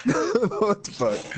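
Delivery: high, very slow drawn-out disbelief; stunned disbelief. Real, not acted. Reacting to a friend's absurd claim, slow stunned. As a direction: stretch it out, stunned.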